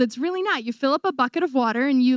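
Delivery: usual speaking voice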